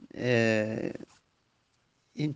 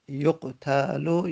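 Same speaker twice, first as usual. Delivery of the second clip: slow model word; 3 semitones higher, much slower than usual. Shown as a demonstration. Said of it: "اولش دمه داره".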